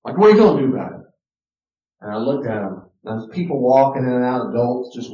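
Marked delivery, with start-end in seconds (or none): 0.2-1.1 loud, higher mock taunt; acting a kid daring the parent; defiant child. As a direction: call it out as a challenge.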